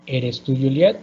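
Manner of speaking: "¿Eres tú Juliet?" climbing on "Juliet"; Spanish yes-no question with lifted "Juliet"; rising intonation. Shown as a demonstration. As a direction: swing "Juliet" up at the end.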